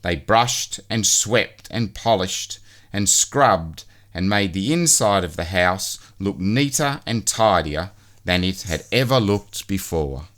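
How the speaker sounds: usual speaking voice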